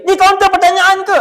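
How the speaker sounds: high, loud demanding bark; mock angry customer. Angry customer at the inquiry counter, mock demand.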